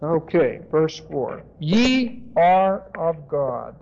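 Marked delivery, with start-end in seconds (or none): 1.6-3.8 "Ye" drawn out long and stressed, each word slow; emphatic stress on Ye. Shown as a demonstration. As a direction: read it word by word, leaning on "Ye". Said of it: "And it's very emphatic".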